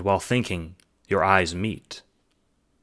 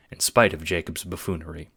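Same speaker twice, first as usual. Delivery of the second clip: ordinary delivery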